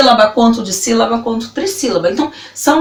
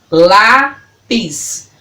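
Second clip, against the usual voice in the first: LÁPIS split into lá - pis; syllable by syllable; 3 semitones lower than usual. Shown as a demonstration. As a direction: sound out LÁPIS in two separated beats. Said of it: "falando elas pausadamente pra você".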